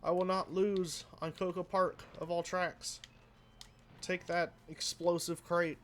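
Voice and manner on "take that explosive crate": normal talking voice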